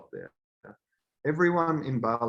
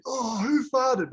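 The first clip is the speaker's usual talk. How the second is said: slow, higher mock accusing voice; mock who farted; 12 semitones higher, 3 dB louder, slower than usual. Guys on the plane sniffing out the smell, mock accusing. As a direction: drag it out, mocking the guys.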